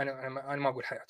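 mumbled, quick, evasive denial; a lightly voiced sheepish apologist backing off; mock defensive denial. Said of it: "حيقول لك لا،".

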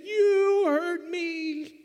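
put-on slow, high little girl whine; hurt little girl. Daughter accuses dad, in a slow, high child's whine. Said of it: "she said".

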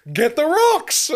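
high, loud mock order; laughing punchline. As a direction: shout the punchline on the edge of laughter. Retells punchline, cracking up.

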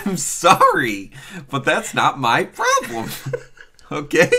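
high and loud, slow, cracking into laughs; fake-apologetic sass through giggles; laughing mock defiance. Teasing the co-host about the accent, laughing sass.

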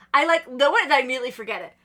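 ordinary talk, nothing marked

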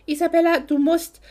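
loud, high, gasping out the name; breathless urgent call. A narrator's reading. Lauren, out of breath from running, calls to Isabella urgently. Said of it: "da sie vom Rennen völlig aus der Puste war".

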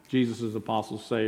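ordinary talk, nothing marked

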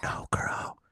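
hushed theatre whisper. Retold or imitated. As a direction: whisper it like in the audience. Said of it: "He's like".

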